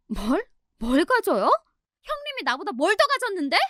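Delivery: high-pitched indignant comeback, rising questions; indignant retort. Acted. Sister-in-law challenging her elder in a quarrel, indignant. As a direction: fire back in a high, offended voice.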